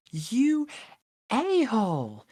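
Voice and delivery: high, loud mock-outraged shriek; girlfriend's playful outburst. Girlfriend playfully slaps him, high loud mock outrage. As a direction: squeal it at him, teasing and outraged. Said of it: "playfully slapped me and goes".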